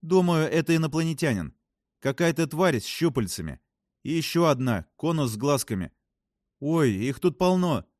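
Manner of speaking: fascinated astronaut. Acted. Voices a story character. (увлечённая астронавтка, высоким голосом)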